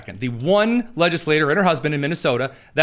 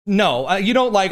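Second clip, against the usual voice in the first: ordinary talk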